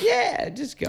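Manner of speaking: high, exaggerated Italian voice; Italian accent. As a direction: ham up a carefree Italian accent. Imitating carefree Italians.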